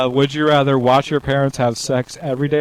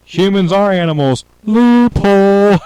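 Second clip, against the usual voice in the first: announcer voice; 8 semitones higher, 5 dB louder than usual. Acted. Reading a would-you-rather answer card.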